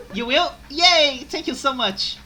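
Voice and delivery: high, loud happy cheer; excited cheer. Acted. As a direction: celebrate brightly. Maid cheers up.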